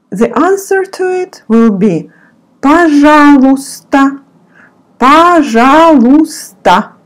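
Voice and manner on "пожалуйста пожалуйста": пожалуйста said as pa-ZHA-lus-ta, with the y dropped; silent y. Shown as a demonstration. Teacher showing learners the silent y in пожалуйста. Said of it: "you don't pronounce y".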